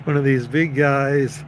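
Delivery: ordinary delivery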